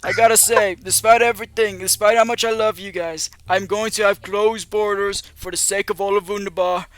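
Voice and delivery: rally the crowd like a bragging candidate; firefighter shouting through mask. Acted. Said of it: "He goes".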